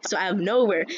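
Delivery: usual speaking voice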